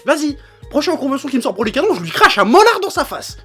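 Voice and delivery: loud, furious ranting; angry rant. Real, not acted. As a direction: yell it out, fed up and furious. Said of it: "je me suis un petit peu énervé".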